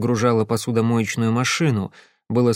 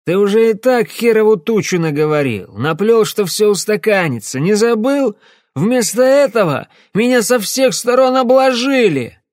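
menacing shout; 10 semitones higher, 6 dB louder than usual. Acted. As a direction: blow up at him, threatening. Drunk client raging at fixer.

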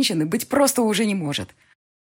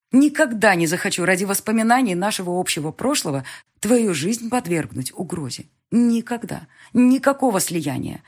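higher, louder, firm never; emphatic never; 5 semitones higher, 5 dB louder than usual. Acted. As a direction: insist on никогда. Firm refusal, insists never.

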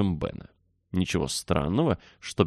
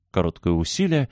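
ordinary delivery, nothing marked

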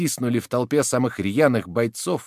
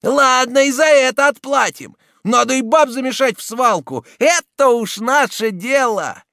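loud, high, defiant yell; vengeful shouting; 14 semitones higher, 7 dB louder than usual. A narrator's reading. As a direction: shout back in outrage. Angry women answering the insult.

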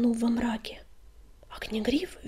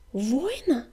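bewildered. A narrator's reading. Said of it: "растерянно повторила она".

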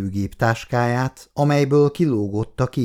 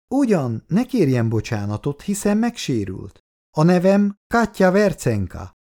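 lightly voiced older woman, a touch higher; a hint of a chatty neighbour woman, raised pitch; neighbour woman's voice; 8 semitones higher than usual. A narrator's reading. Neighbour reassures and introduces herself. (enyhén megformált idősebb szomszédasszony hangja)

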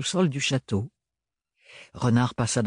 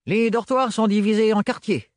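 explaining; 9 semitones higher than usual. A narrator's reading. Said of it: "expliquait-il".

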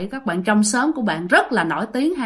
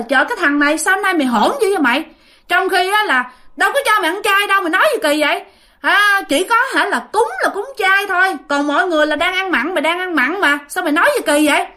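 high, mildly heated telling-off; acting out indignant relatives, pitch raised; retold scolding; 8 semitones higher than usual. Relatives scold the young man for being rude, indignant scolding. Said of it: "thì mọi người mới la".

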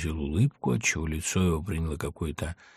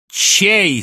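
loud, high impatient question; exasperated high shout; growing fury; 11 semitones higher, 10 dB louder than usual. A narrator's reading. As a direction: snap out the question angrily. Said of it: "свирепея спросил гусар".

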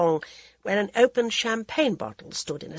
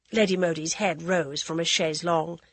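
plain reading voice

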